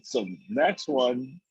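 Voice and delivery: ordinary talk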